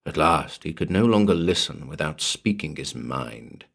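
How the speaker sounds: ordinary delivery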